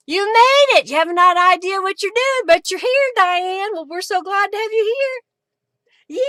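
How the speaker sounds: loud, high, delighted greeting; excited welcome. Real, not acted. Crafter welcoming Diane to the live, excited. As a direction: call out joyfully to a late arrival. Greets a viewer who just arrived.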